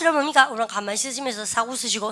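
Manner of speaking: ordinary talk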